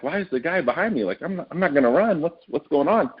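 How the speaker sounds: half-joking alarm at the guard behind him; nervous inner thought. Retold or imitated. Traveler wondering why a guard stands behind him, mock nervous. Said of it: "and I'm like".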